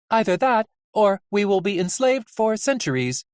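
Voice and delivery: high, even character voice; high, unbothered nurse; deadpan Nurse Ratched. Acted. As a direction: predict doom matter-of-factly, high-pitched. Nurse Ratched predicts doom.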